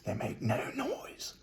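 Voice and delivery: usual speaking voice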